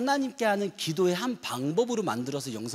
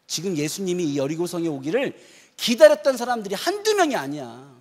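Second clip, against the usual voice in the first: ordinary talk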